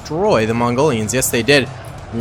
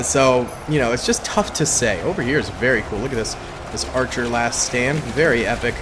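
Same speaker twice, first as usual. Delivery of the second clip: ordinary talk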